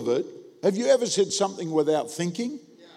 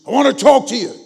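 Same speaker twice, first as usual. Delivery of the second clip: loud, raised-pitch imitation of a confrontation; sudden forceful retold challenge; forceful demand; 6 semitones higher, 9 dB louder, faster than usual. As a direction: demand it loudly, imitating an angry churchgoer.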